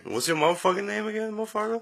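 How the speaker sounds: high, fast hype-man call; hype announcer bit. Acted. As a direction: call it out like a show host. Mock host hype.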